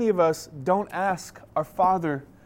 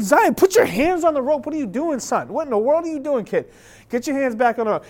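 high, raised-voice mock scolding; exasperated dad; 8 semitones higher, 5 dB louder than usual. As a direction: act out a frantic dad correcting his son. Acted dad scolding distracted son.

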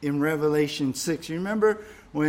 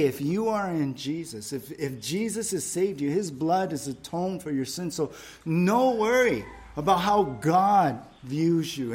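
ordinary delivery

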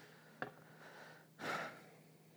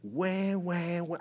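higher mock baby crying wah; mock baby wail; 7 semitones higher, much slower than usual. Believer crying to God like a baby, mock wail. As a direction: wail lightly like a fussing baby. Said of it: "a hungry baby cries".

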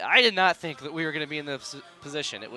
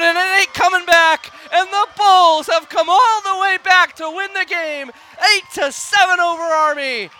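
raised, thrilled broadcast call; excited play-by-play; 12 semitones higher, 13 dB louder, slower than usual. Real, not acted. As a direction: ride the celebration for the listeners. Live play-by-play celebration.

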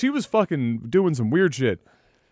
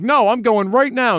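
defiant insistence; 8 semitones higher, 4 dB louder than usual. Retold or imitated. Girl insists, storming off.

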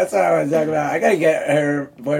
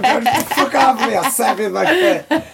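much higher pretend rant; mock angry threat; 9 semitones higher than usual. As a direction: threaten in hammed-up rage, pitched way up. Mock quarrel over gaming addiction.

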